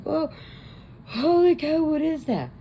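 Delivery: put-on the new kid gawking at the corpse; a high, astonished holy cow; shocked kid. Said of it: "The kid's like".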